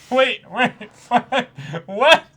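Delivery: loud high what through laughter; laughing disbelief. Real, not acted. Host seeing a silly Roger edit, laughing loud and high. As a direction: crack up mid-question.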